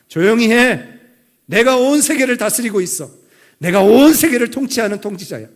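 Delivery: loud, high voice of God declaring authority; commanding proclamation. Acted. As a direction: proclaim each promise forcefully, as the ruler. Voicing God's sovereign declaration.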